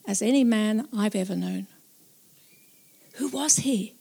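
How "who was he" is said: awed whisper. Acted. Narrator wonders who Jesus was, hushed whisper. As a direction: breathe the question in wonder.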